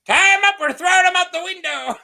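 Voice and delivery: high, loud, fast mock order; commanding old lady. Yellow Toad ordering Rosby thrown out, loud high command. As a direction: bark it gleefully like a vengeful old lady. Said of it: "the old lady's like".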